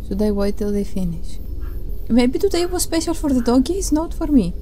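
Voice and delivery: ordinary talk, nothing marked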